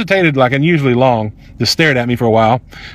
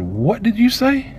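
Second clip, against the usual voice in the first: high, drawn-out disbelieving question, slightly softer; shocked challenge; 7 semitones higher, 4 dB quieter, much slower than usual. Retold or imitated. Customer to the woman who leaned in, shocked challenge. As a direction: stretch it out, rising high in disbelief.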